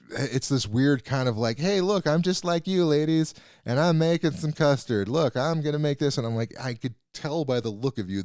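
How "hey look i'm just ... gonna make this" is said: higher, perky fake-friendly blogger voice; mock influencer. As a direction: parody a chirpy recipe blogger talking to ladies. Mocking a recipe-blog influencer.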